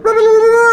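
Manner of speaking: very high, loud creature gibbering; shrieked nonsense fish-creature chatter; Kuatoa babble. Acted. Other Kuatoa jabber at the departing passenger, high loud babble. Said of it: "some other Kuatoas, they're like".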